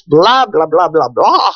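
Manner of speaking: singsong blah-blah, last one swooping; dismissive skimming. Real, not acted. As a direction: skip the boring fine print. Skipping rest of job listing.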